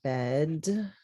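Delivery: slow, low "bed", pause, "bug"; slow blend. Shown as a demonstration. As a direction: stretch out "bed bug" as the final answer. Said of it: "blending after sounding out".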